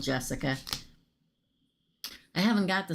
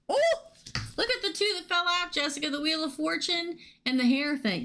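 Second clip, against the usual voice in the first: excited; 12 semitones higher, faster than usual. Real, not acted. Two cards fell out, excited.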